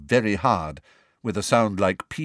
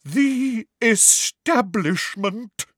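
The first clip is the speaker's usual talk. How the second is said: slow, high theatrical pronouncement; drawn-out melodramatic declaration; dramatic, wavering; 13 semitones higher, 3 dB louder, much slower than usual. A narrator's reading. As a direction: draw it out with wavering grandeur. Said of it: "in a deep, dramatic, wavering voice".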